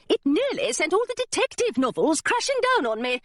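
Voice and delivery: high, swooping grumble; high-pitched scolding bookseller; indignant complaint. Acted. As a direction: complain with affronted fuss.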